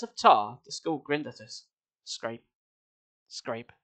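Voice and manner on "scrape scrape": hushed, low scraping word; soft sound effect. A narrator's reading. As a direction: breathe it out quietly. Sound effect read softly.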